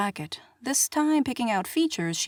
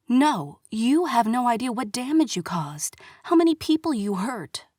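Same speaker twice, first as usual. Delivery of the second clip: furious whispered retort, pushed hard; angry whisper; 3 semitones higher, 3 dB louder than usual. Acted. Kara hissing back at the prince in an angry whisper. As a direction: spit it back in a fierce whisper.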